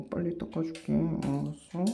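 usual speaking voice

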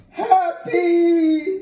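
loud, high excited shout. Real, not acted. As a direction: shout the name with delight. Excited shout at cast name.

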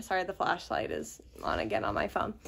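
ordinary talk, nothing marked

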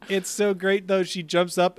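ordinary delivery

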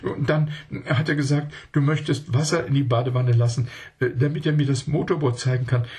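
put-on high, hurried child's voice; begging Else to send him away; pleading child. A narrator's reading. Scared child begging Else to send the man away, high and hurried.

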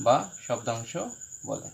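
word by word with long pauses, low-key; board dictation. Real, not acted. Dictating while writing on the board.

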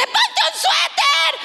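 high, slow shouted mom warning; motherly shout. Retold or imitated. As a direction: yell it after her like a worried mother. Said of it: "A lo que yo grité". (grito alto y lento de mamá preocupada)

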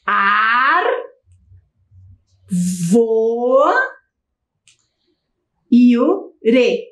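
each syllable drawn out slowly and held. Shown as a demonstration. Teacher counting syllables for pupils, syllable by syllable. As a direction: sound out the word in separate syllables. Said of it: "Vamos conferir lá".

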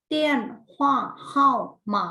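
"เตี้ยนหว่าเฮา หมา" high and loud, syllable by syllable; pronunciation drill. Shown as a demonstration. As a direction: project "เตี้ยนหว่าเฮา หมา" with gaps between syllables. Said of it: "ทวนการออกเสียงอีกครั้งหนึ่ง".

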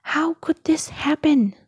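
high-pitched storybook lament. A narrator's reading. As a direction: wail in disbelief, lightly acted. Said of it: "wailed Chick Hicks".

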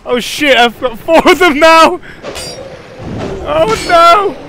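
high, loud panicked cry; panicked shout. Real, not acted. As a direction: yell in real alarm. Surrounded by four enemies.

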